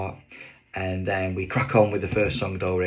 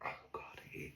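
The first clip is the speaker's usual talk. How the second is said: unvoiced, cringing whisper; whispered oh God; 23 dB quieter than usual. Real, not acted. Comedian whispers while recalling the painful moment. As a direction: breathe it out in real embarrassment. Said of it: "it was painful".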